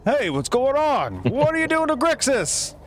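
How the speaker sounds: high, alarmed tavern crowd; alarmed patrons. Acted. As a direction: call out in alarm at the fight. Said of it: "the other patrons are like".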